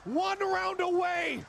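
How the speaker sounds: high, loud, slow hype call; hyped caster shout. Real, not acted. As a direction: roar the match point call. Caster hype at match point.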